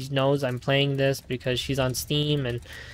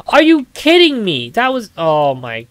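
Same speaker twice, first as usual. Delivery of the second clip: high, loud disbelieving cry; real gamer outrage, pitched way up; exasperated outburst; 6 semitones higher, 12 dB louder than usual. Real, not acted.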